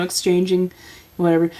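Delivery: ordinary talk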